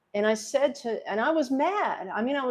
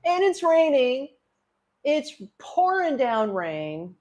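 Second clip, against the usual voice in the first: high, loud, tearful break in the voice; hit by the memory; 6 semitones higher, 5 dB louder, slower than usual. Real, not acted. Mother reliving the drive home, hit by the memory. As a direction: choke up and push the words out high. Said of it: "You can see how it's hitting me".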